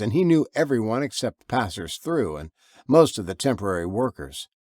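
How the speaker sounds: ordinary delivery, nothing marked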